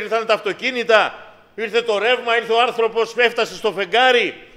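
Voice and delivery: δυνατό, ψηλό, φλογερό κήρυγμα; φωνάζει το μήνυμα στο εκκλησίασμα; κήρυγμα με πάθος. Acted. Preaching at full force. (κηρύττει δυνατά με πάθος)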